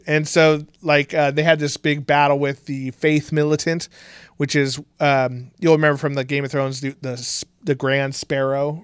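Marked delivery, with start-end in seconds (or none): none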